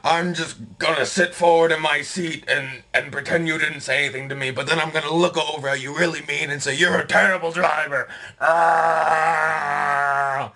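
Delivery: high-pitched parody of a flustered angry man; huffy puffy. Retold or imitated. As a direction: whine and bluster in a mock tantrum. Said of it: "he gets all huffy puffy".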